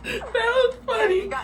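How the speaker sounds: high, slow, through helpless laughter; laughing hard. Real, not acted. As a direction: laugh so hard the words squeak out. Laughing fit at fall video.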